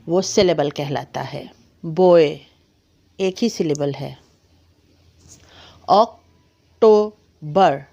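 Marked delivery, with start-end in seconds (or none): 5.9-7.8 "अक्टूबर" said very slowly in three beats; drawn-out three-syllable "अक्टूबर"; syllable demo. Shown as a demonstration. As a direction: split "अक्टूबर" into ak-too-bar. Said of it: "तीन syllables हैं।".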